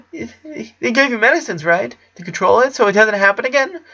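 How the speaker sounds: put-on high nervous boy voice with a shaky laugh; clutching at a last hope; high anxious kid; hopeful Clyde. A narrator's reading.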